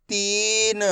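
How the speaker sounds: तीन with a long ee, light final n; stretched pronunciation. Shown as a demonstration. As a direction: hold ती, then release a short न in तीन. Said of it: "मैं इसका खींच के उच्चारण कर रहा हूँ".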